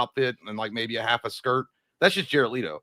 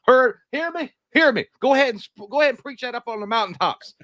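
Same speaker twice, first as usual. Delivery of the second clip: high, loud Southern preacher cry; mock Southern exhortation, pitched way up; Southern accent; 10 semitones higher, 5 dB louder than usual. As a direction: call it out like a hammed-up Southern preacher. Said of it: "once you get down in that Southern accent".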